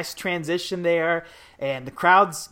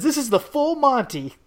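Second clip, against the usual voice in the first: excited announcer quote, pitched up; Jay Lawler; 8 semitones higher than usual. Acted. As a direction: call it out as a high-voiced commentator. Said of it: "And Jay Lawler says".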